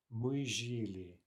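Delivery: slow Мы жили with stress on жи; drawn out жили, stress the first syllable; slow learner model of жили; stress demo. Shown as a demonstration. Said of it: "watch the stress".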